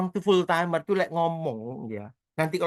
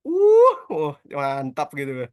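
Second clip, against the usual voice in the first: awed exclamation; 3 semitones lower, 7 dB louder, slower than usual. Real, not acted. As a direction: let the wow burst out, then settle. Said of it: "Merinding saya".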